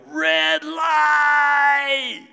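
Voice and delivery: high, loud panicked yell; kids yelling. Retold or imitated. Kids in the back seat yelling at the driver to stop. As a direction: scream the warning from the back seat. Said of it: "the kids start yelling".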